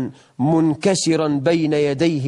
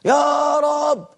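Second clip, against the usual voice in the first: much higher and very slow, a wailing drawn plea; raising his voice loudly; 10 semitones higher, much slower than usual. Retold or imitated. Companion raising his voice to God, long high wailing plea. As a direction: stretch each يا رب into a long high cry. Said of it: "بيرفع صوته قوي".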